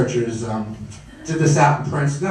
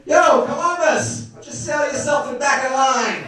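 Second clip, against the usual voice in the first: Brooklyn uncle; 6 semitones higher than usual. Retold or imitated. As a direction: call him out like a tough Brooklyn uncle. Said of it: "my uncle would be like".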